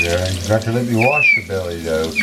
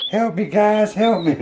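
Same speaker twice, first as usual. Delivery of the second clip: mock pleading monkey voice; 11 semitones higher than usual. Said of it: "He's like".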